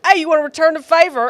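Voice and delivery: high, loud Aussie imitation; bad Australian accent. Australian dad asking a tourist to return the favor, badly imitated Australian accent. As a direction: have a rough go at sounding Australian. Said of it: "I'm doing a terrible job here".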